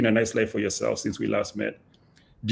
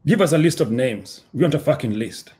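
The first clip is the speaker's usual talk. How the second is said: loud demand; 3 semitones lower than usual. A narrator's reading. Captor demands names from blindfolded prisoner, moderately loud. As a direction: demand it with a raised voice. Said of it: "a loud voice".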